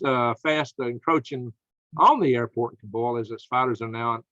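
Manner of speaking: usual speaking voice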